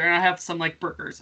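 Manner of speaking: usual speaking voice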